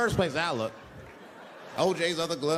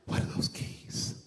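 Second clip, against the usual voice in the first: whispered question; 4 dB quieter, slower than usual. Retold or imitated. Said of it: "She said".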